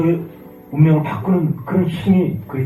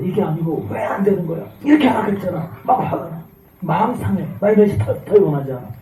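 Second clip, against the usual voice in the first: higher, whiny mock grumbling; mock frustrated complaint; 8 semitones higher, 4 dB louder than usual. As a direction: act out a sulky believer who wants his way. Mimics self-willed believer's complaint. (투덜대며 짜증 내는 흉내)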